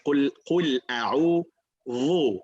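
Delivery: Qul a'udhu said slowly and carefully; slow model recitation. Shown as a demonstration. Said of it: "So you say".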